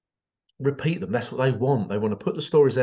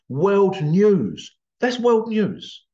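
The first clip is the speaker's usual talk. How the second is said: excited announcing; 7 semitones higher, 4 dB louder, much slower than usual. Retold or imitated. Announcing big news.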